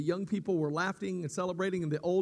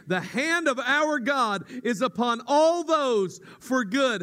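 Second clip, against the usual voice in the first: higher, louder preacher's scripture read; 5 semitones higher, 6 dB louder than usual. Acted. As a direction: proclaim the verses to the church. Reads scripture aloud to congregation.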